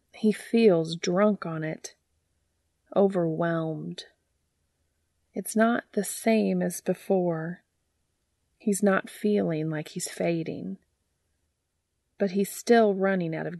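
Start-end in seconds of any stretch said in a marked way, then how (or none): none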